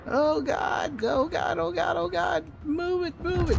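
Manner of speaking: very high, strained pleading; real panic. Real, not acted. Gamer begging the destroyer to move it, panicked. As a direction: squeal it in mounting dread.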